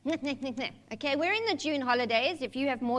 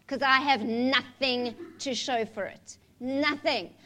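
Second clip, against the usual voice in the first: ordinary talk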